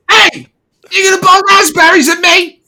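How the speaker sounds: very high, loud, shouting little man; a furious little tin-suited man screeching; angry armoured dwarf charging the hero, shrill shout. Acted. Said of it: "a very angry little man".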